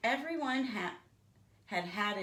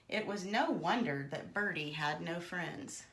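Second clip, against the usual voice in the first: usual speaking voice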